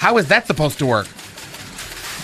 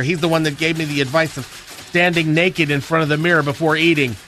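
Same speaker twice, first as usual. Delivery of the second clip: ordinary talk, nothing marked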